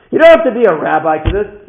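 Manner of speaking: high, very loud exhortation; impassioned shout. Real, not acted. Speaker exhorts listeners with the lesson, impassioned shout. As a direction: hammer the point home at full voice. Repeated line, shouted second take.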